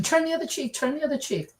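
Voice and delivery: mocking parroting. Mimicking people who think Christians never fight back, high mocking voice. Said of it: "A lot of people think that".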